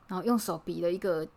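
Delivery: ordinary talk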